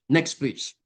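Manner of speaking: normal talking voice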